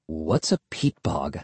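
low, soft, unruffled question; subdued question. A narrator's reading. Narrator ignoring her insults, subdued question.

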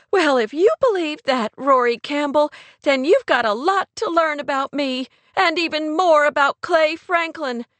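indignant, high-pitched outburst; high, almost hysterical. A narrator's reading. Kate cuts Rory off, high and near-hysterical. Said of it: "high and almost hysterical".

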